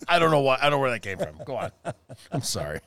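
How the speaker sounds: ordinary talk, nothing marked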